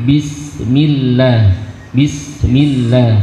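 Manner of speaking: Bismillah with b going straight into sin; correct Bismillah. Shown as a demonstration. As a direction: say Bismillah without stretching the b. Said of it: "langsung masuk pada huruf sin".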